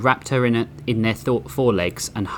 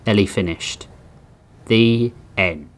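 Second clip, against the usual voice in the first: ordinary delivery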